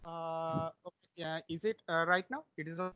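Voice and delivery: faint voice far from the mic; very soft, hard to hear; barely audible. Real, not acted. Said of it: "your voice is very low".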